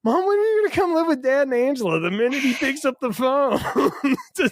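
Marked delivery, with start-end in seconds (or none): 0.0-2.0 put-on kid voice. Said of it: "the kid wouldn't say".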